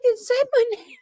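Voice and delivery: high-pitched imitation of a chatter freaking out; excited squeal. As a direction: squeal it like a fan who just got noticed. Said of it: "He's like".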